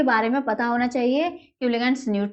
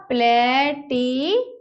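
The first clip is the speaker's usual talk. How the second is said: Palatinate broken into long, separate syllables; slow dictation; much slower than usual. Shown as a demonstration. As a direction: stretch Palatinate out while writing it. Repeats it slowly while writing. (Palatinate को टुकड़ों में, धीरे बोलना)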